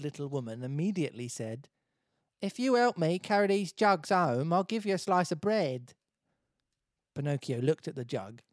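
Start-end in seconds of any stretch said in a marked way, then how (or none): 2.4-5.9 higher, louder Essex lady; TOWIE Essex accent. Acted. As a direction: make the offer in TOWIE Essex. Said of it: "Can you make her towie".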